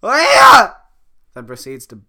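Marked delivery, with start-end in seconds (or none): 0.0-0.7 huge high battle cry; hammed-up martial arts shout; karate yell. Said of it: "Fiona gives a karate yell".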